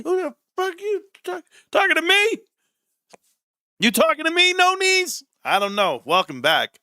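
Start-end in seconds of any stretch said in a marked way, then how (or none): 0.0-5.2 very high-pitched challenge; hammed-up high confrontational comeback; mock indignation. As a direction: play offended in a squeaky-high register. Acted indignant reply to being called sweetie.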